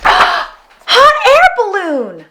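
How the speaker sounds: high, slow, amazed reveal; gasped wonder. A narrator's reading. Narrator revealing the balloon to the listener, amazed. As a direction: marvel at the balloon.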